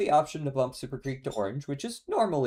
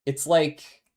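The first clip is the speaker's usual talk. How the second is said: excited; 7 semitones higher, 9 dB louder than usual. Real, not acted. Excited about the song.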